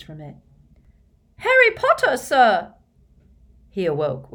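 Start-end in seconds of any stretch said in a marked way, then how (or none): 1.4-2.7 loud, very high squeak; piercingly high elf cry; squeaky house-elf. A narrator's reading. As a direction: call out in an excited elf squeak. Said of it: "said a squeaky voice".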